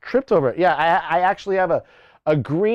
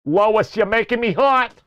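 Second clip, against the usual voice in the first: higher, put-on cartoon husband voice; playful, pitched-up husband impression; Peter; 5 semitones higher than usual. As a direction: flirt as a goofy cartoon dad. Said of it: "Oh, Lois".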